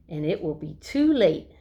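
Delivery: usual speaking voice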